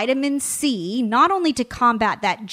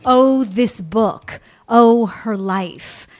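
slow gushing awe; 4 dB louder, much slower than usual. Real, not acted. Host gushing over the book.

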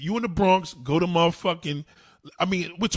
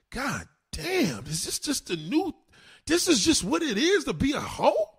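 shocked disbelief; 9 semitones higher than usual. Retold or imitated. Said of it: "And I said".

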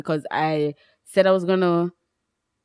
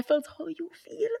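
whisper; 7 semitones higher, 11 dB quieter than usual. Real, not acted. Confessing embarrassment to a friend.

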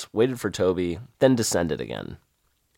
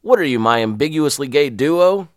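louder snapping sergeant voice; 5 semitones higher, 6 dB louder than usual. Retold or imitated. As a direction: snap it at the recruits. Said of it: "Tubby snapped".

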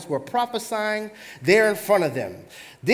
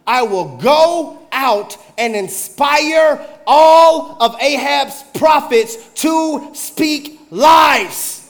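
very slow, loud, rising to a shout on lies; booming slow spirit; 4 semitones higher, 6 dB louder, much slower than usual. Acted. Lying spirit proclaiming its plan before the Lord. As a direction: boom it out to wake the room. Spirit voiced, preacher wakes listeners.

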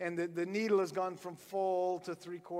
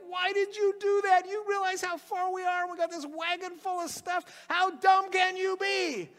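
much higher, exasperated wife voice; scolding wife; 9 semitones higher than usual. Retold or imitated. Wife scolding the farmer about the dead mule. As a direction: nag him in a shrill-free high scold. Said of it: "she's like".

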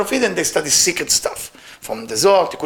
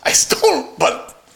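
acted wail climbing from usual pitch to very high; crying plea; 7 semitones higher than usual. A narrator's reading. Thief lamenting on his dead master's grave, tearful and wailing. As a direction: plead higher and higher as if breaking into tears. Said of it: "start crying".

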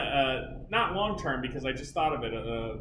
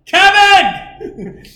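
much higher, louder mock yell across a distance; 16 dB louder than usual. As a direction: call out laughing from a rock. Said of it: "get on top of a rock and yell".

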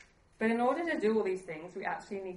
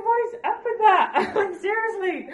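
much higher, louder, laughing in disbelief; 13 semitones higher, 13 dB louder than usual. Retold or imitated. Mother retelling tearing up in the kitchen, laughing high. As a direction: squeal it, amused at herself. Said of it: "And I'm like".

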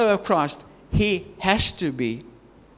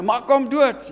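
lightly mimicked crowd, pitched higher; egging them on; 6 semitones higher than usual. Preacher voices crowd at a street fight, goading. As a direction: imitate a spectator goading fighters. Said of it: "the crowd was egging them on".

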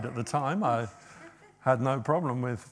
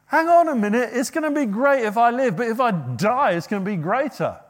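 excited Paul; 8 semitones higher, 8 dB louder than usual. Retold or imitated.

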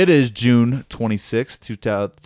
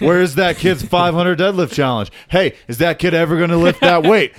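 mock nagging fans; 6 semitones higher, 4 dB louder than usual. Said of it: "people asking".

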